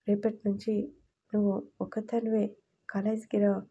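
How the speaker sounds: usual speaking voice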